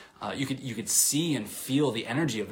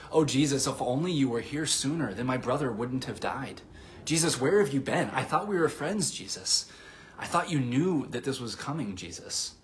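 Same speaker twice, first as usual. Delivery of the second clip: higher, pleading voice of grievance; hurt reproach; 4 semitones higher than usual. Acted. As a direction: reproach a friend who let you down, dropping on the final name. Acts out Mary reproaching Jesus.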